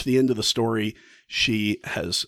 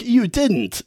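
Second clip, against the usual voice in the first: shocked disbelief; 12 semitones higher, 4 dB louder than usual. Retold or imitated. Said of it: "the other two women are like".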